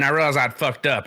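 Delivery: ordinary talk, nothing marked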